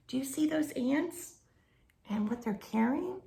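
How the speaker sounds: ordinary delivery, nothing marked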